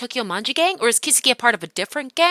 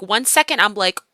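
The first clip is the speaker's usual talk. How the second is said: usual speaking voice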